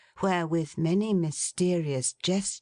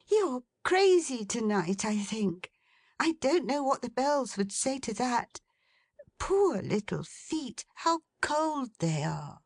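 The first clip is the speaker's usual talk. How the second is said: put-on much higher, light young woman's voice; Meg, young woman; 8 semitones higher than usual. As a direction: raise the pitch to play a fond daughter.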